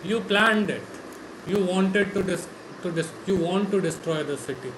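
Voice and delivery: normal talking voice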